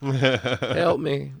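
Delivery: high mock plea for help; imitated victim's high plea; pleading. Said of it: "Pearl pleads to her".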